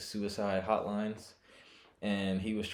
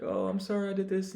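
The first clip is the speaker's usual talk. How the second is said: mock apology; 10 semitones higher than usual. Quoting an apologizing person.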